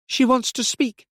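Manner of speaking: high, strained-up pitch over the telephone; Robbie on the phone. A narrator's reading. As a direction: report it down the line.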